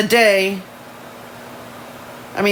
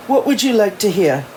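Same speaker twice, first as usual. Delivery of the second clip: put-on amateur English accent. Elijah offering to talk about Abigail, amateur English accent. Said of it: "my shitty English accent".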